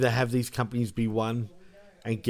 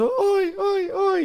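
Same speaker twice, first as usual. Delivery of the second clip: very high falsetto ois; imitated high oi; 3 dB louder, much slower than usual. Contrasting the tall wrestler's voice, high-pitched imitation. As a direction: flip the ois up into a squeak.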